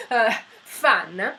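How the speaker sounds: laughs through the word fan; a real burst of laughter, then fan; giggling self-consciously; laughing. Real, not acted. Laughs at becoming juice fan.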